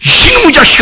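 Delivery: high, loud mock outburst at a child; hammed-up parental yell; mock scolding. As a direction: play the irritated parent yelling.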